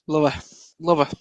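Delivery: ordinary delivery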